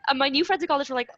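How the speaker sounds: ordinary talk, nothing marked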